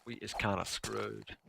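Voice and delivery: slow, soft goofy voice; silly voice. Shown as a demonstration. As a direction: say it slowly and dopey. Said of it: "I don't know why I'm talking like this".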